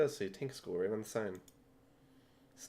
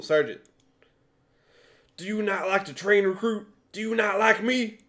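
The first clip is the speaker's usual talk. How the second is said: drill sergeant; 10 semitones higher, 15 dB louder than usual. Acted. Drill sergeant yells at recruit.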